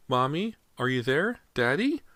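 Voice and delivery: put-on high, scared little boy calling; little boy calling from nowhere; frightened child. A narrator's reading. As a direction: call out lost in the dark.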